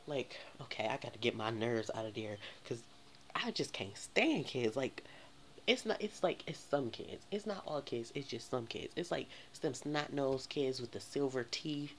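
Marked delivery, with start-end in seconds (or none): none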